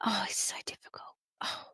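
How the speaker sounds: whispered, fake heartfelt sympathy; soft whispered parody of a pitying friend; mock pity whisper. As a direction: breathe it like a pitying gossip.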